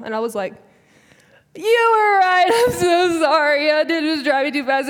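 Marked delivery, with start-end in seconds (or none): 1.6-4.9 high, loud wail, gradually easing; mock tearful confession. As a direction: bawl the apology like a comic crybaby, then subside. Crying confession to mom, retold.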